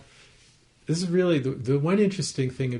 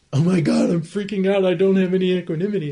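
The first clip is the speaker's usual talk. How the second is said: much higher and louder, fast, swept up in panic; panicked freak-out; 8 semitones higher, 11 dB louder than usual. Shown as a demonstration. Teacher showing students what getting identified sounds like, in a frantic, panicked voice. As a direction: blurt it out as if the panic has totally taken over.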